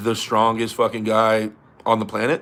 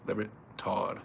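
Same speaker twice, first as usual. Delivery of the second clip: much softer, lower musing to oneself; trailing off; 5 semitones lower, 16 dB quieter than usual. Real, not acted. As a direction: let "Larrett, Todd" fade almost to nothing. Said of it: "weighing the pick aloud".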